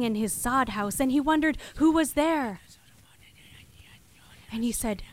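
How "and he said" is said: ordinary delivery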